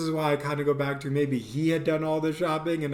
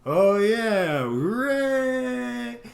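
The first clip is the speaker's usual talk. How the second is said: high, louder mock cheer; sarcastic hooray; 9 semitones higher, 4 dB louder, much slower than usual. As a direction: fake enthusiasm, dripping sarcasm. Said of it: "There's no way he's gonna be like".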